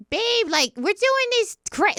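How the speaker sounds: high, slightly raspy impression; Teresa's raspy high voice. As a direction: go up high with a little rasp. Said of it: "little raspy when she goes up".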